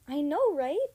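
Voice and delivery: high, loud delighted squeal; excited squeal. A narrator's reading. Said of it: "Zoe squealed".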